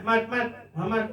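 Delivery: imitating disrespectful chummy calling; calling a buddy. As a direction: call out like shouting for a friend. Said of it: "seperti kalian manggil konconya".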